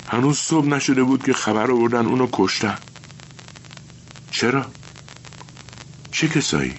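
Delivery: put-on a child telling hard news plainly; boy explaining.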